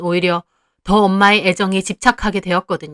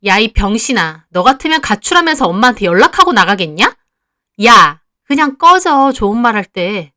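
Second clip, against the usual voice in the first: angry sister; 5 dB louder than usual. Acted. Younger sister telling her sister to get lost, loud and contemptuous. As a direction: spit the insult and drag it out.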